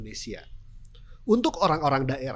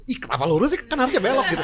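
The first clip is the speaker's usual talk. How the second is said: louder, higher baffled self-talk while laughing; laughing mock confusion at a wrong turn; a rider baffled at missing the turn; puzzled self-talk; 7 semitones higher, 7 dB louder, faster than usual.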